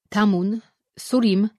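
loud call, names rising. Acted. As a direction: call both names across a distance. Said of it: "zawołał".

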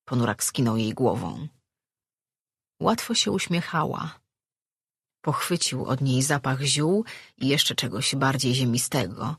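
usual speaking voice

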